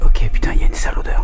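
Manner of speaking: tense whisper; scared horror game streamer. Real, not acted. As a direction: whisper it, spooked. Scared in dark attic.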